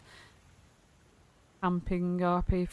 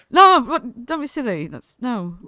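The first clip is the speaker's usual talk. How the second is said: flustered denial, higher and louder at the start; Gleam; 6 semitones higher, 8 dB louder than usual. Acted. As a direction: burst out with a protest, then back off. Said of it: "she's like".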